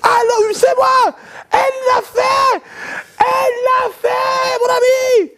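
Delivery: very high slow triumphant yell; high ecstatic drawn-out shout; excited boss shouting. Retold or imitated. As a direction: scream out the good news slowly. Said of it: "crier, hausser le ton comme ça".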